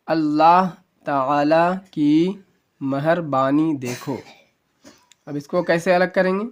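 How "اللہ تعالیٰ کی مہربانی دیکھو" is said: slow, deliberate word-by-word read; slow reading aloud. Shown as a demonstration. Teacher reading the sentence to students, slow and careful. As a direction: read it out slowly for learners. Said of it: "اب یہاں پر سے پڑھتے ہیں".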